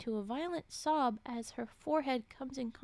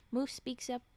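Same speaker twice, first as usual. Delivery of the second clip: usual speaking voice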